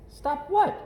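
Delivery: high, bright little cub voice, greeting everyone; cheery little girl. Acted. Little Sister greeting every passerby, cheery high girl voice. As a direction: sing out hello to every passerby.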